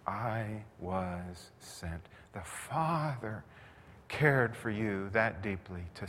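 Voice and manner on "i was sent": very slow and soft, reverent; slow, solemn. Acted. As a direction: lay each word down gently. Repeated slow quote.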